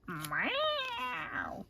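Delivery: playful cat imitation; cat meow. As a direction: mimic the cat meowing. Imitating her daughter's bratty cat.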